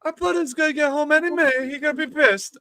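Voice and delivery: high-pitched mock panic; put-on high, worried whine; retold worried fretting. Worried housemate voiced in the story.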